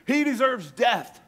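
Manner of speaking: high, loud, slow condemning shout; crowd verdict. Acted. Council answering the high priest, slow loud condemnation. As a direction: spit out the death sentence together.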